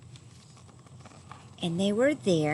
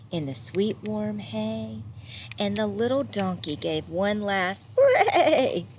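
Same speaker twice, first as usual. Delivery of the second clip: ordinary delivery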